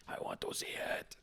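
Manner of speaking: soft, creepy whisper; creep's whispered tagline. Acted. Trying out his tagline again, in a creepy whisper. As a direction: whisper it all as a mock creep. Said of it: "maybe his tagline should be".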